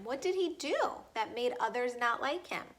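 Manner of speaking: ordinary delivery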